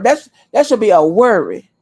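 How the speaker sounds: ordinary talk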